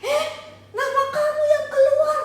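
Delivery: a high, loud burst of surprise; shocked gasp. Acted. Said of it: "sekarang orang-orang semua kaget".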